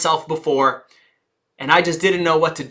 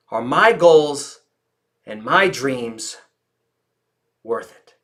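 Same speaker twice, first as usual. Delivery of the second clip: slow, fading, weighted question; slow reflective question; 4 semitones lower, slower than usual. Shown as a demonstration. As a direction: let each phrase hang. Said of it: "Listen to the volume of my voice".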